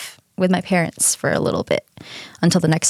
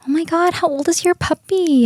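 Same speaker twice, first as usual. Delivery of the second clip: neighbor; 10 semitones higher than usual. Retold or imitated. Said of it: "a neighbor stopped me and she was like".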